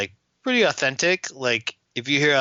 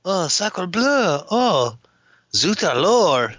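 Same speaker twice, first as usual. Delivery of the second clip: mock French accent; 7 semitones higher, slower than usual. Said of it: "French accent".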